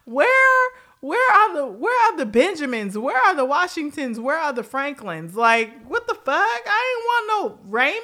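high, loud incredulous squeal; mock outraged stripper. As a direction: play a stunned dancer hunting for real bills. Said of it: "and was like".

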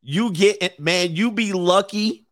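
ordinary delivery

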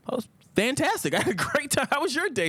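put-on high, falsely cheerful kid voice; boy lying to his uncle about school, sounding high and sweetly innocent; fake innocent. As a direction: play it sweet and innocent to hide trouble.

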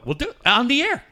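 high, fast, eager reply; excited. Retold or imitated. Hosts egging on a prank caller, high and fast. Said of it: "And we go".

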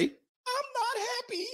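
high, soft, slow mocking wife voice. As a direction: pout it out as the unhappy wife. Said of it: "she gonna say".

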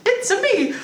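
high cartoon Italian plumber voice; chirpy video game plumber; Mario voice. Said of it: "It's Mario".

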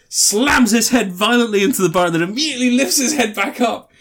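ordinary talk

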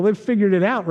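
usual speaking voice

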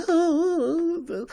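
very high helium squeak; exaggerated helium-voice imitation. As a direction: squeak it way up high, mocking a spunky bandmate. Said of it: "in your [name] impersonation".

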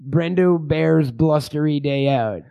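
slow, drawn-out film title; title announcement. Pretend. Host announces a made-up porn title, slow and deliberate. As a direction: stretch out the title slowly.

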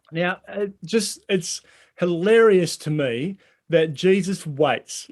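ordinary talk

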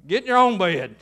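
loud, firm send-off to bed; stern parental order. Acted. Parent sending child back to bed, loud and stern.

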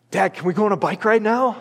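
put-on high, fast eager plea from a kid; eager child. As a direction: blurt it out like an impatient boy. Son bursting out as plate empties.

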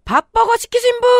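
high calling shout. Retold or imitated. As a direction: call out for the customer. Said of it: "의문의 남성이 소리를 치기 시작했습니다".

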